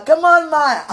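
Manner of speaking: high, loud mock holler; mimicking sweetie. Woman corrects her impression of sweetie, high loud mimicking holler. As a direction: holler it out as a teasing imitation. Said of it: "You'll be more like".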